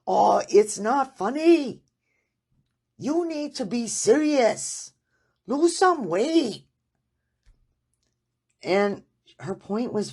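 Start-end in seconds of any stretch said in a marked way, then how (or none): 0.1-6.7 imitating an upset Hong Kong-accented doctor, much higher and louder; stern Hong Kong accent, scolding the laughing patient. Doctor annoyed her patient laughs, Hong Kong accent, higher. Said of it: "with her Hong Kong accent".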